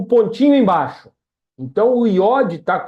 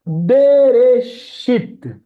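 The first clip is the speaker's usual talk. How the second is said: Bereshit drawn out very slowly, syllable by syllable; slow demo; 5 semitones higher, 3 dB louder, much slower than usual. Shown as a demonstration. Teacher modelling the word for the student, very slow. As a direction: stretch out Bereshit with a laugh.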